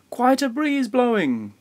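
high-pitched storybook bellow. A narrator's reading. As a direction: bellow it as if calling out. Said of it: "bellowed Grandfather".

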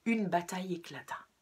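ordinary delivery, nothing marked

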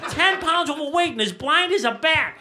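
ranting punchline. Real, not acted. Loud self-mocking rant.

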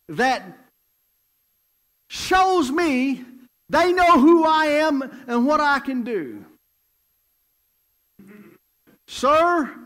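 loud, high, slow triumphant proclamation; proud king. Acted. King praising the man's huge request, loud triumphant proclamation.

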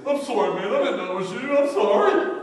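a tough inmate caught out, begging forgiveness; rising panicky apology; apologetic inmate. Retold or imitated. Cussing inmate suddenly apologizes.

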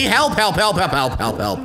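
hammed-up cries for help; mock calls for help. Real, not acted. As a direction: call out for help. Being attacked, calling help.

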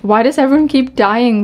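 ordinary talk

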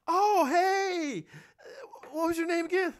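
high, airy mock voice of a star who forgot him; snobbish actress.

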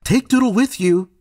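Mama calling her son from afar; mother calling out. A narrator's reading. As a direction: call him like a mother from another room. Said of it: "Mama would call from wherever she was".

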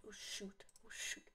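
breathy whispered oh shoot; whispering. Real, not acted. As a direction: drop to a whisper. Fading into a whisper.

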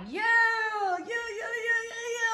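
high, stretched-out repeated calls; excited hype. Retold or imitated. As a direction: draw out the first, then string them along high. Acts out her reaction to filming.

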